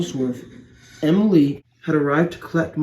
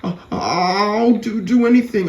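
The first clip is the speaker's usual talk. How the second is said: pleading cry; 6 semitones higher than usual. Acted. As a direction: beg for help, tripping over words. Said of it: "The lorry then cried".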